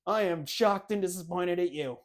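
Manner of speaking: mock disappointed dad. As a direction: play the let-down parent half-jokingly. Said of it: "it's like".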